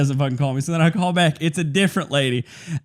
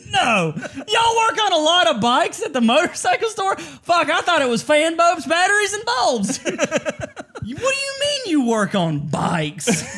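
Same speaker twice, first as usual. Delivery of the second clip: much higher, slow, shouted disbelief; laughing sarcastic outrage; mock shock; 12 semitones higher, slower than usual. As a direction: gasp it in fake astonishment. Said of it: "And I'm like".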